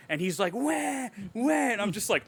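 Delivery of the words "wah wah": high imitated wail; cartoonish "wah" sob, pitched way up; mock crying. Retelling Rocket crying over Lila, high mock wail. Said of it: "Rocket starts crying".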